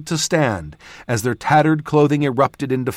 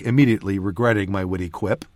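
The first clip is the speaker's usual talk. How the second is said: ordinary delivery, nothing marked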